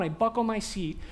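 usual speaking voice

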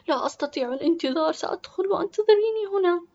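put-on high, rushed girl's voice; impatient schoolgirl. Sister insists she cannot wait.

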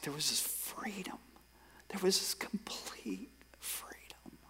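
hushed whisper, slowing on "complete freedom"; awed whisper. Real, not acted. Preacher recalling the burden lifting, awed whisper. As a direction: let the relief out in a whisper. Awed retelling of sudden relief.

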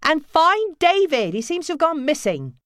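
high, loud, slowed call; mother shouting up the stairs; calling upstairs. Acted. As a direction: keep shouting up. Nancy shouting on.

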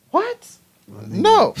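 very high mock-outraged protest; retold disbelief. Learner disputing examiner's claim, incredulous. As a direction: squeak out your disbelief.

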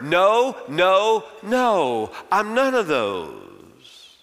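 short, loud, clipped noes; John answering curtly, loud then slow; curt replies. Acted. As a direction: cut them off one by one. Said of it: "His responses become more curt".

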